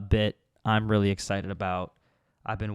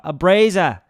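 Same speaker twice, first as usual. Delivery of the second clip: put-on Australian accent; 5 semitones higher, 6 dB louder than usual. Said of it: "The Australian ones ... with the accent".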